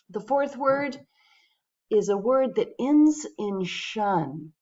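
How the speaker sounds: normal talking voice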